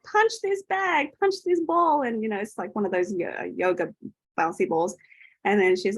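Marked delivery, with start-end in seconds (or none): 0.0-2.0 eager urging. Retold or imitated. Healer urging a client to punch the ball, eager. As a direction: coax her to let fly.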